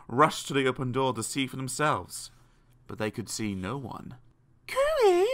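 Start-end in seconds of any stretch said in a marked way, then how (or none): 4.7-5.3 very high cooee; high-pitched coaxing call; calling from above. A narrator's reading. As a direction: sing out a high hello. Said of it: "said a voice above them".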